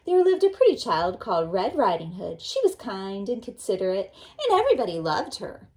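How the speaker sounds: put-on expressive children's story reading; storybook reading. A narrator's reading. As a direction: read it like a bedtime storybook to the class. Teacher reads fairy tale aloud.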